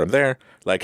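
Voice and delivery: usual speaking voice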